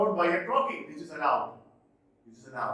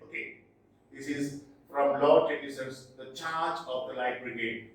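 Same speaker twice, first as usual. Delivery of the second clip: ordinary delivery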